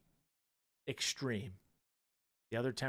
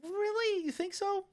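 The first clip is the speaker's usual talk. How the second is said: much higher, squeaky mocking echo. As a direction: parrot him in a squeaky voice. High mocking echo of co-host.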